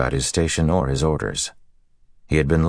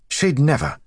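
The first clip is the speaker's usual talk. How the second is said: sharp, high-pitched retort; indignant snap; snapped; 12 semitones higher than usual. A narrator's reading. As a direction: snap back in defence. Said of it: "Gawain snapped".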